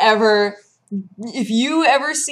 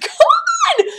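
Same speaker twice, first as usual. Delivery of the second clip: much higher, louder laughing yell; laughing mock shout; 6 dB louder than usual. Raging at the opponent's legendary, laughing mock shout. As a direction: cry out in mock outrage.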